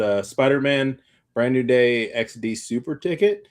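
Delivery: usual speaking voice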